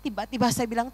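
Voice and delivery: normal talking voice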